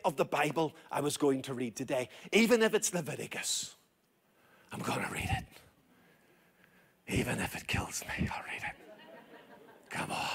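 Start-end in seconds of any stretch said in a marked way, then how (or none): 4.7-10.3 hushed, breathy determination; dramatic whispered promise; whispered resolve. Acted. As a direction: whisper it like a secret vow. Preacher whispers resolve to read Leviticus.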